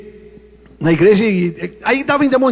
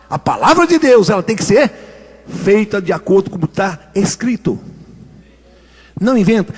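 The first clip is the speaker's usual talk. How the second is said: usual speaking voice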